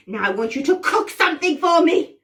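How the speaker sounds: bossy witch. Pretend. Witch orders the players through her chores, bossy. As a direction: order them about with relish. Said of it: "I'm going to order you around".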